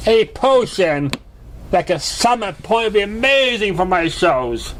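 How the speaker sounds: high, showy mare voice, musing; a performer already planning her act; Trixie. Acted. Trixie thanks Pinkie and muses on the potion, high and showy.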